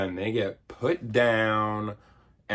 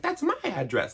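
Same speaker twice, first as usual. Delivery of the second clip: put-on much higher, eager little-kid voice; Billy's voice. Billy realizing the address is his, squeaky and eager. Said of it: "And Billy's like".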